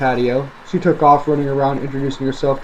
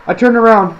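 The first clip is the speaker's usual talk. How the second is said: plain reading voice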